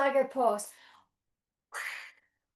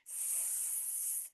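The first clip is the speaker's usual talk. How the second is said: long voiceless sss; snake hiss; 28 dB quieter than usual. Pretend. Teacher being the snake that is back, long soft hiss.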